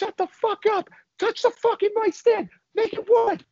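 very high, strained imitation of screaming; frantic hammed-up screeching demand; mock screaming. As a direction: scream it in a squeezed high voice, volume held back. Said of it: "turns into us screaming".

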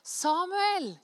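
high, loud summons by name; calling out. Acted. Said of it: "Da ropte Herren".

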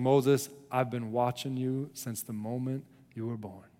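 low, soft and intimate, with long pauses; God speaking to Moses. Acted. God tells Moses he has a job for him, low and hushed. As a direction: speak quietly, letting each statement settle. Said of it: "It's like God is saying".

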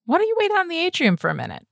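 high, quick request to leave; pointed request. A narrator's reading. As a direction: usher the friend out in a hurried high voice. Asks friend to step out politely.

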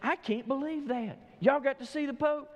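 high-pitched, mildly incredulous stylist; playfully astonished; mock shock. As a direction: act out disbelief, pitch lifted. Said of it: "the stylist in shock looked and said".

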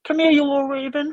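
high, loud squawking bird voice; raven call voice. Pretend. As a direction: screech it like a calling raven. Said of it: "I can do a Raven call".

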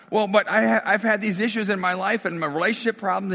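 lightly hammed-up objection; mock protesting. Client protests once more.

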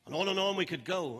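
normal talking voice

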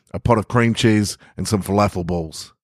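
ordinary delivery

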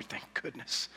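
whispered thank goodness under the breath; relieved whisper. Retold or imitated. Relieved sinner whispering to himself, hushed. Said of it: "and you're".

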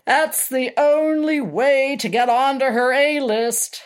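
plain reading voice

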